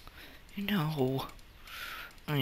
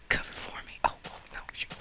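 tense whisper; 7 dB quieter, much faster than usual. Real, not acted. Sneaking, danger.

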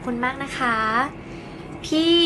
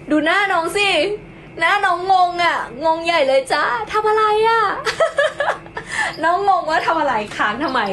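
higher, cooing and giggling at the cat; baby talk to pet; 6 semitones higher than usual. Acted. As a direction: tease the puzzled kitten fondly. (เสียงเล็กแซวน้องแมว)

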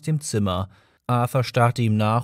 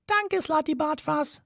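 high, firm little mouse; a mouse closing the matter; 3 dB quieter than usual. A narrator's reading. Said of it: "sagte Benji bestimmt".

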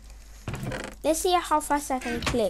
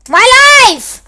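loud yelled word, higher; exasperated yell; 12 semitones higher, 20 dB louder than usual. Real, not acted. Player yelling about being chased, exasperated yell. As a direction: blurt it out in exasperation. Chased all his life.

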